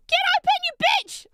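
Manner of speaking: high, loud, fast yell at a stubborn box; exasperated outburst; frustrated shout. Real, not acted. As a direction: yell while straining to pry it open. Fighting to open the box.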